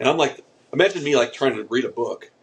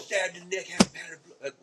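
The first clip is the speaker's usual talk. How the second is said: put-on Australian accent; 6 semitones higher, 6 dB quieter than usual. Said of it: "We're doing Australians again".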